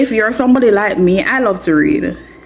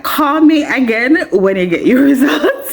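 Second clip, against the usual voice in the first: laughing mom quote; 8 semitones higher than usual. Retold or imitated. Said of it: "Mommy's like".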